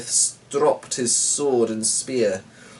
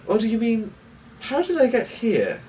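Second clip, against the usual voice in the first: higher, airy and smooth; sly stranger; 11 semitones higher than usual. A narrator's reading. Mysterious stranger greeting the pair, airy and smooth. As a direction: greet them too sweetly.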